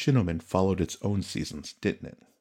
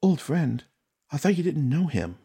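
put-on high, puzzled old friend; surprised aside; 9 semitones higher than usual. Oshi leans in to question Tsukune, much higher and surprised. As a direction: echo old friend back in disbelief. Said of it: "Oshi leaned to whisper".

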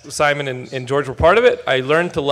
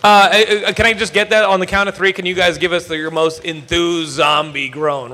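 ordinary talk

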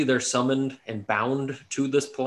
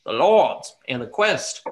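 hype announcer; 5 semitones higher, 4 dB louder than usual. Acted. Hyped podcast host opening.

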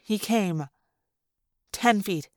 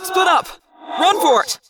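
high pressing command; urgent order; 6 semitones higher than usual. Acted. Jake telling the others to scatter, urgent. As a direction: snap the order out.

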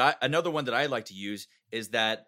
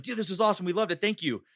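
higher-pitched retold gushing; enthusiastic praise; 8 semitones higher than usual. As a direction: imitate a delighted client thanking an actor. Retelling the team's delighted praise.

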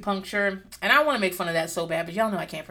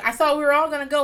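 put-on higher, louder, fast kid's protest; imitating confused kid; 6 semitones higher, 7 dB louder, faster than usual. Host voices confused daughter Aaliyah, higher, louder and fast. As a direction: play a bewildered child blurting it out. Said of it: "so that's why she's like".

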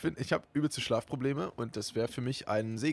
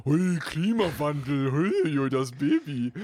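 dumb voice; 2 semitones higher, 4 dB louder, slower than usual. Shown as a demonstration. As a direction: parrot it back like a total idiot. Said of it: "in so einer vollkommen dummen Stimme".

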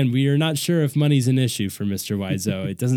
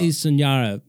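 put-on Tommy accent echo; 2 semitones higher than usual. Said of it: "Oh, there it is".

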